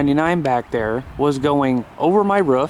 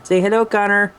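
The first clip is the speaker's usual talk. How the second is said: higher, louder sing-song coaxing; cheerful higher call to his puppy; talking to the puppy; 7 semitones higher, 4 dB louder than usual. Real, not acted. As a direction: coax the dog to say hi, higher and louder. Greeting the puppy on camera.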